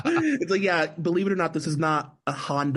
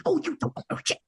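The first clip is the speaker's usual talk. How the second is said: high, unvoiced-sounding stage whisper; quoted line in a hushed whisper; imitated whisper; much faster than usual. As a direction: whisper it like the film character. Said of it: "when she whispers it".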